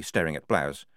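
ordinary delivery, nothing marked

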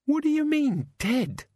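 very high, incredulous demand; sharp, high-pitched disbelief. A narrator's reading. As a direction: demand an explanation, pitch shooting up. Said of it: "Susan demanded".

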